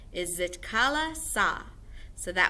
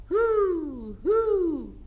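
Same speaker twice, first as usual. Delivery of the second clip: high, loud hooting owl; 10 semitones higher, 7 dB louder than usual. Shown as a demonstration. Teacher shows the class an owl's hoot. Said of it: "the next one is owls hoot".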